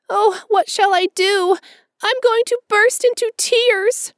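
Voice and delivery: put-on high, loud girlish voice close to tears; an orphan girl pouring out her despair. A narrator's reading. Orphan girl to Marilla and Matthew, high despairing wail.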